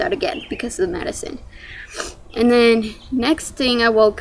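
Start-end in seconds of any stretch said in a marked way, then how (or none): 0.0-1.4 fast whispered aside; whispering. Real, not acted. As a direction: whisper it quickly under your breath. Aside while telling birth story.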